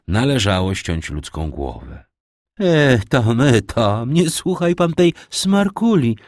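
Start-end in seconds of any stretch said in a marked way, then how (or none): 2.6-6.2 put-on higher, louder, brushing off the girl; dismissive marchioness. A narrator's reading. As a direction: wave away the silly girl. Margrabina interjects dismissively. (wyższy, głośniejszy, lekceważący głos margrabiny)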